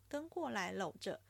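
ordinary delivery

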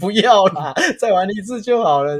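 put-on tired kid begging to stop. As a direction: whine like a worn-out child.

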